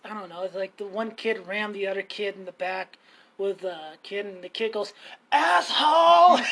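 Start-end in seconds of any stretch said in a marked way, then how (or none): 5.3-6.4 loud, high drawn-out shout; mock outraged kid yell; kid's yell. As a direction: imitate a kid hollering an insult, slow and high. Retold kid yelling an insult.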